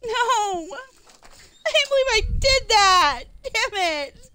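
high, slow disbelieving whine; dismayed whine. Real, not acted. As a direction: groan it out in disbelief. Dismay over the spilled chips.

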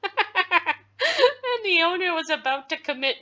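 talking through real laughter; amused, words broken by laughs; laughing. Real, not acted. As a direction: crack up while explaining. Laughs at the story twist.

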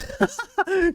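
sudden high laugh; burst of laughter. Real, not acted. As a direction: crack up laughing in disbelief. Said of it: "Hiç gülesim yoktu".

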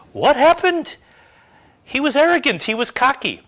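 high, loud mock astonishment; mock disbelief. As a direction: squeal it out like a baffled onlooker. Mocking onlookers' disbelief.

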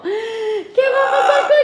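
high, laughing half-shout; laughing cry. Real, not acted. Nervous vlogger wondering about the pet, laughing squeal. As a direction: squeal the question through a laugh.

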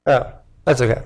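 ordinary delivery

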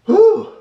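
loud high-pitched whew; sharp high whew. Real, not acted. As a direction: blow out the heat, sharp and high. Burning mouth, exhaling hard.

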